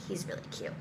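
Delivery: whispered under breath; breathy secret whisper. Retold or imitated. Whispered aside.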